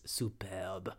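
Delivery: "superbe" in a mock French accent. Host quoting Ina's last word, French accent. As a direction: give it a brief French flourish. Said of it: "in French accent as she says".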